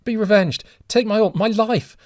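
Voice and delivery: high, loud, desperate pleading; anguished begging. A narrator's reading. Kneeling father begging the stranger to take his life instead, anguished. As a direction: offer your own life in anguish.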